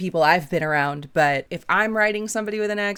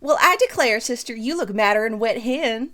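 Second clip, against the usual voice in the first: hammed-up Southern belle; 4 semitones higher than usual. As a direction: read it as a teasing, over-the-top Southern sister. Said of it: "intentionally being super Southern".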